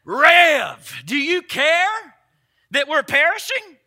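shouted "Rev", then slow pleading question; modern-vernacular plea. Retold or imitated. Preacher voicing a scared disciple in today's slang, shouted plea. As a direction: call out to the pastor, then drag the words. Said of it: "Let me put that in our vernacular".

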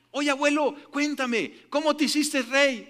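mock little child pleading for a story; child's voice. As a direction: ask grandpa like an eager kid. Grandchild asking grandpa.

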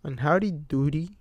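plain reading voice

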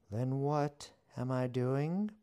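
high, slow teasing question; witch voice. A narrator's reading. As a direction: pitch it up and draw it out. Witch voice held.

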